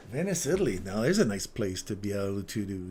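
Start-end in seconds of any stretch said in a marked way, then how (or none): 0.1-0.8 Venice, Italy sung out high and loud; excited. Real, not acted. Trader dreaming of Venice, excited call. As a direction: savor the place name, rising and bright.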